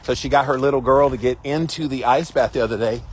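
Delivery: ordinary talk, nothing marked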